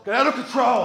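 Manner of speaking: mock dramatic shout. Joking exaggeration.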